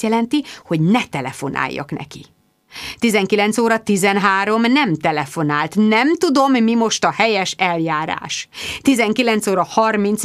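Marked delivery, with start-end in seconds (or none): none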